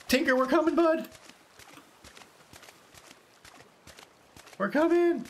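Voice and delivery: very high singsong call; high-pitched calling out. Pretend. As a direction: call to a faraway pet in falsetto. Calls out to pet in game.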